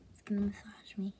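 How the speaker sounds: quick soft whisper; hushed ASMR whisper. Real, not acted.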